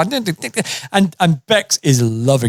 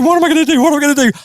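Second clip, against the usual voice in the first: a frantic friend imitated; mock panic; 12 semitones higher, much faster than usual. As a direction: play the headless chicken. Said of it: "running around like a headless chicken".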